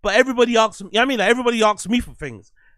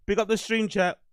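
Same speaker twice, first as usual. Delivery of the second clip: normal talking voice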